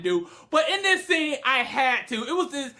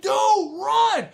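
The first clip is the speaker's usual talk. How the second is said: high, loud warning shout; hammed-up urgent scream; yelling at the screen; 11 semitones higher, 6 dB louder than usual. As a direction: yell a frantic warning.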